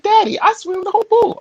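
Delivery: put-on squeaky little girl bragging to daddy; little daughter voice. Three-year-old telling dad she swam the pool, high child voice. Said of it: "She was like".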